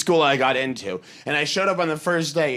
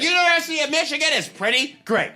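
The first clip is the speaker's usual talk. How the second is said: high-pitched, peppy campus pitch; chirpy tour guide; 11 semitones higher, 3 dB louder, faster than usual. Acted. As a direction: sell the school with mock enthusiasm. Imitates a keen campus guide.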